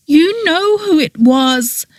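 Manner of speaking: high, tearful and pressing harder; tearful, insistent. A narrator's reading. Petra insisting to Oberon, tearful and insistent. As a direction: push it on him through tears. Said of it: "tearfully, but even more insistently".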